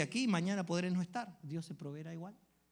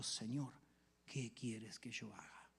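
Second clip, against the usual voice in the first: much softer, slow whispered surrender; humble whisper; 5 semitones lower, 8 dB quieter, much slower than usual. Acted. Pablo before Christ's lordship, slow humble whisper.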